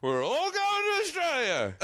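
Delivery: high, drawn-out exclamation; mock film announcement. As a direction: announce it in a high, stretched put-on voice. Acting out imagined film's storyline.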